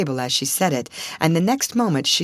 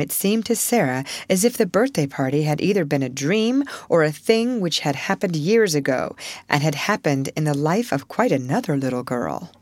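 ordinary delivery, nothing marked

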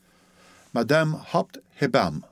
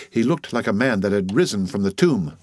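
ordinary delivery

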